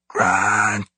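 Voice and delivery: raspy, strained ape voice; gorilla whisper. A narrator's reading. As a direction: breathe the name out as a gorilla. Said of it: "the gorilla whispered".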